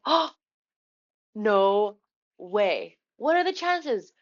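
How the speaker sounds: can't believe the luck; excited disbelief. Retold or imitated. Thrilled at rare candy.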